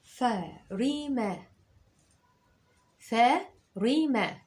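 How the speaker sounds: "ثريمة" very slow, stretched; teacher dictating slowly; slow dictation. Shown as a demonstration. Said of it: "اكتب معايا".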